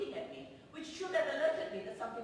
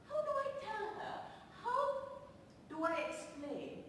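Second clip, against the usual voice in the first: dismayed wondering; 9 semitones higher than usual. Acted. Inner dismay at friend's suggestion.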